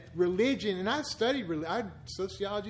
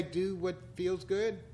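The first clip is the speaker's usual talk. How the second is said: mock tempter; 4 dB quieter, slower than usual. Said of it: "there's gonna be people say".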